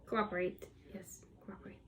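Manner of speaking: coaxing whisper; hushed pleading to the marker. Real, not acted.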